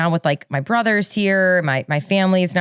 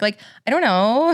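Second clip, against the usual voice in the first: "I don't know" rising like a question; questioning uptalk; 4 semitones higher than usual. Shown as a demonstration. Showing Valley Girl speech, "I don't know" with uptalk. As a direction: lift "know" at the end, Valley Girl style. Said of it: "like, a question mark".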